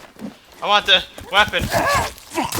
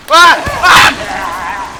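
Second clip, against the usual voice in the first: very loud screams, the first very high; terrified screams; 8 semitones higher, 13 dB louder than usual. Real, not acted. Player searching for Timmy gets startled, terrified screams. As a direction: scream out at a jump scare. Jump scare in game.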